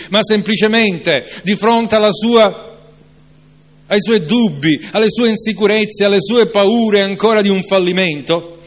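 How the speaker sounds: fervent preacher rebuking doubt; preaching at full force. Acted. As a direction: build the sermon to a passionate peak. Preacher's rising passage.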